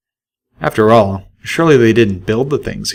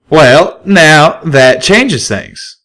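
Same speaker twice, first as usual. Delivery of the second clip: louder, higher drawling stallion; a slow, loud reconsidering stallion; loud stallion, slow at the end; Calamity; 7 semitones higher, 6 dB louder, much slower than usual. A narrator's reading.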